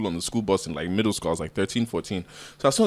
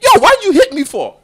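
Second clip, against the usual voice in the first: high, loud aggrieved question; mock outraged protest; 9 dB louder than usual. Child protesting a slap to mom, mock outrage. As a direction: cry foul, pitched way up and loud.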